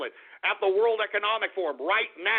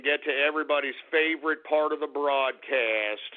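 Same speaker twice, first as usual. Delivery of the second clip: segment announcer; 5 semitones lower than usual. Real, not acted. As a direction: stretch it out like a big announcer reveal. Host announces favorite segment.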